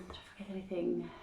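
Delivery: ordinary talk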